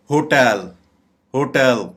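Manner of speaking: "होटल" said ho-TEL with a full open e; correct pronunciation. Shown as a demonstration. Teacher modelling the correct word, "होटल" as ho-TEL. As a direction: stress the second syllable of "होटल": ho-TEL. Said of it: "इसकी correct pronunciation".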